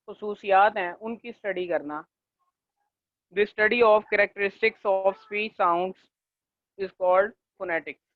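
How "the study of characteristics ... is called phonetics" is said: very slow, word by word, for note-taking; slow dictation. Shown as a demonstration. Teacher dictating a definition to students, very slow. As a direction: read it out slowly so they can write. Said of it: "वो आप लिख लें।".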